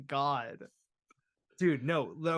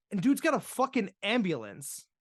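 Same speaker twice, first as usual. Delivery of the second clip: usual speaking voice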